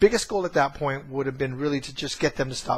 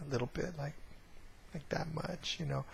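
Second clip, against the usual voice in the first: much softer, sheepish little reply; a child barely owning up to staying angry; small sulky admission; 15 dB quieter than usual. Retold or imitated. Younger son telling dad he's still a bit angry, much softer sulky voice.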